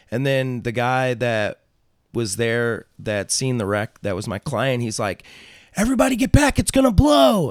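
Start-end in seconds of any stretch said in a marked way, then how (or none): 5.8-7.5 high, loud imitated alarm yell; bystander's panicked yell, acted out at full pitch; shouted warning. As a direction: shout to clear everyone from danger. Retold bystander warning of explosion.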